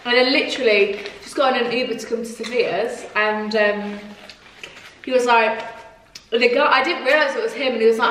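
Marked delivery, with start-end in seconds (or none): none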